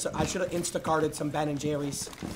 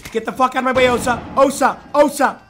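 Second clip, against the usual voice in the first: yelling higher and louder, pure rage; furious shout; 7 semitones higher, 14 dB louder than usual. Real, not acted. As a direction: scream the name, losing it. Teammate blocking him in game.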